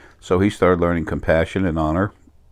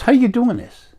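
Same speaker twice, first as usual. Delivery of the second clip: excited question; 5 dB louder than usual. Retold or imitated. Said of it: "he's excited".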